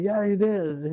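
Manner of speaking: high-pitched mock insistence; retold accusation. Gunman insisting to the clerk in retold bickering, mock accusation.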